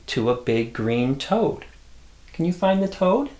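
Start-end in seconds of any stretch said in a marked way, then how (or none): none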